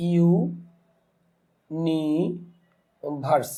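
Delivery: Universe stretched very slowly as ইউ-নি-ভার্স; slow teaching breakdown of universe in three pieces; syllable by syllable. Shown as a demonstration. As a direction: hold ইউ and নি long, pausing before verse. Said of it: "তাহলে এখন দেখা যাচ্ছে".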